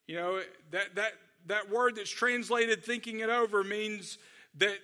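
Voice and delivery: ordinary talk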